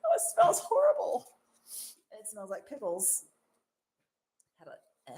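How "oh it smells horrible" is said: high squeal of laughing disgust. Real, not acted. Reacting to the pickle smell, laughing squeal.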